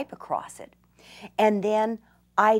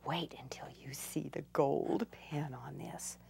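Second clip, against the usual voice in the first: much softer, lower whisper; hushed teasing whisper; 4 semitones lower, 14 dB quieter than usual. Real, not acted. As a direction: build suspense under your breath. Teasing the next step.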